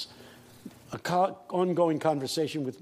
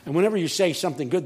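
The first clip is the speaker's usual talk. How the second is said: ordinary delivery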